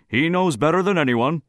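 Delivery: high, loud, commanding assertion. A narrator's reading. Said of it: "Brinker interrupted commandingly".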